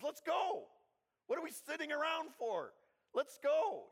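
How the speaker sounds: loud, high, impatient rallying call; raring to get moving, voice thrown high; stir-crazy urging. Acted. Jonathan to his armor bearer, stir-crazy and urging. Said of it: "getting a little stir crazy".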